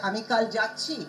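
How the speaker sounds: final word "যাচ্ছি" picked out; stress on যাচ্ছি. Shown as a demonstration. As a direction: put the weight on "যাচ্ছি" at the end. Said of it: "you'll stress some".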